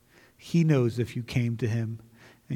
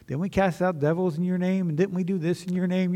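higher-pitched protesting quote; mock pleading; 6 semitones higher than usual. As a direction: act out a raised-pitch plea of self-defence. Acting out the rejected pleading in scripture.

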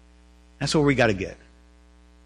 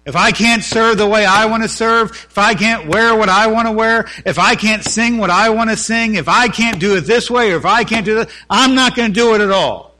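louder, higher mock sulky ultimatum; stubborn complainer; 5 semitones higher, 6 dB louder than usual. Preacher mocking churchgoers who refuse to serve, hammed-up stubborn whine. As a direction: play the member who quits if not his way. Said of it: "I've met plenty of people who say that".

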